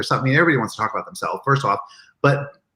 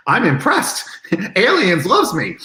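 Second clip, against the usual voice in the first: giddy delight; 8 semitones higher, 3 dB louder, slower than usual. Retold or imitated. Gushing about Sigourney's praise.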